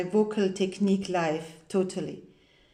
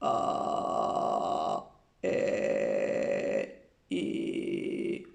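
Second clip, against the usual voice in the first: very low creaky fry on open vowels; vocal fry demo on vowels; 5 dB quieter, much slower than usual. Shown as a demonstration. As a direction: let the voice crackle slow and loose at the bottom. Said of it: "Vocal fry is this".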